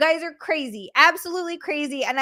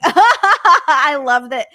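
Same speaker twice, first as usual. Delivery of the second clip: laughing; 10 dB louder, much slower than usual. Real, not acted. Amused by phone's reply.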